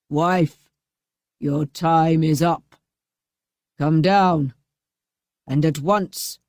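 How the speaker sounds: slow menacing commanding voice; Bluebeard, mock shouting. A narrator's reading. Bluebeard ordering his wife down. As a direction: menace her from below. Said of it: "shouted to her".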